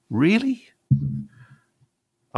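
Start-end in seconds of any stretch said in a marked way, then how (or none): 0.1-0.7 high, loud scoffing disbelief; a shocked, mocking "really?"; incredulous. Real, not acted. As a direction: throw it up sharply in disbelief. Incredulous at press acceptance.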